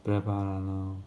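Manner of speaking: soft, muddled Prepáralo on a level low drone; timid mumble. Shown as a demonstration. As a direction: mumble it unsurely, barely committing to the syllables. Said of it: "You can't be like this".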